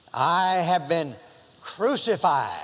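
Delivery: slow, measured quote; solemn recitation. Acted. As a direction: recite it with reverence. Quoting memorized verse slowly.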